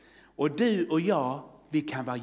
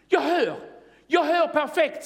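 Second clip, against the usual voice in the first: high, loud, imitated cry of joy; amazed cry; 11 semitones higher, 4 dB louder than usual. Healed girl crying out to the room, amazed cry. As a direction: call it out high, as if just discovering it. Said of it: "Hon bara ropar till så här".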